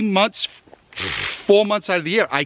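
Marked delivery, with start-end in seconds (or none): none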